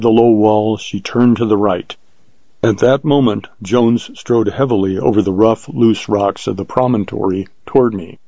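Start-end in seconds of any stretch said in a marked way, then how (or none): none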